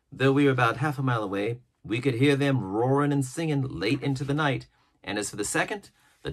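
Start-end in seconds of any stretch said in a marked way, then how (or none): none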